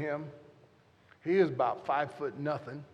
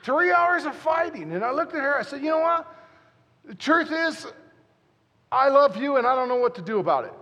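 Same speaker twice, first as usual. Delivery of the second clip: much higher, louder, fast blurted confession; climactic confession; 10 semitones higher, 9 dB louder than usual. Real, not acted. Preacher bursts out the confession to the crowd, high and loud. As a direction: blurt it out at the peak.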